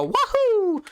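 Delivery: high-pitched imitated cheer; cartoon catchphrase whoop, leaping up high; imitated wahoo. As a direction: whoop it way up high like a game hero. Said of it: "the alternate take of the".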